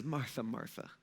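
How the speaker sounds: Jesus gently calling Martha back; gentle address. Acted. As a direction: call her name softly like a loving friend. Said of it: "He says".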